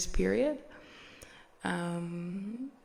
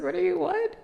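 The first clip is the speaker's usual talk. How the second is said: high, startled half-question; surprised and a bit louder; baffled husband; 11 semitones higher, 6 dB louder, faster than usual. Retold or imitated. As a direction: caught off guard, blurting out. Husband baffled by wife's reaction.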